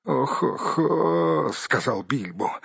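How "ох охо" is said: pained groan. A narrator's reading. Hobbit groans after being flung down, pained. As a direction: groan weakly, drawn out.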